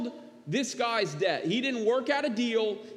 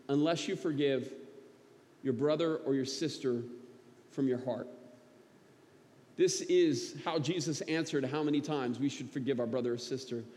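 hushed, heartfelt sermon close; 4 semitones lower than usual. Acted. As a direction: bring it down to the heart. Quiet earnest closing exhortation.

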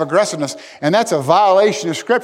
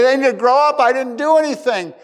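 mock sulky protest, pitched up; whiny petulant voice; 8 semitones higher, 3 dB louder than usual. Preacher mimics a sulky churchgoer, whiny and high. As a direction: imitate a pouting grown-up. Mocking the petty person's excuse.

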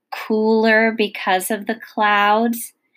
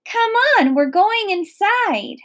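high, urgent call over rain; raised voice calling someone in; hollering; 12 semitones higher, 4 dB louder than usual. A narrator's reading. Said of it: "hollered at him over the rain".

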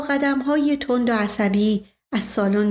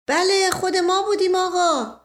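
servant voice; 8 semitones higher than usual. Acted. Mash Qasem answers his master.

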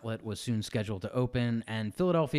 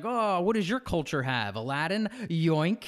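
mock greedy Disney voice; 6 semitones higher, slower than usual. Mocking Disney's attitude.

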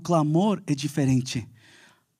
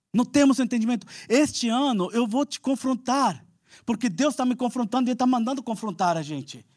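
higher, intense preaching; full-force preaching; 6 semitones higher than usual. Real, not acted. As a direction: preach it hard to the church.

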